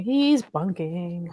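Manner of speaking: drawn out, slow and knowing; slow knowing aside. Retold or imitated. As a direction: stretch it out like a private thought. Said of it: "Misha sa-, thinking".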